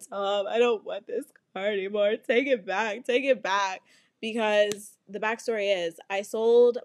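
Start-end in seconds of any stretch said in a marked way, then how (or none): 0.1-3.8 mock-tearful whine; lightly hammed-up weepy begging; crying plea. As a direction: imitate sobbing down the phone to stepdad. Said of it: "I cry, and then I call my stepdad".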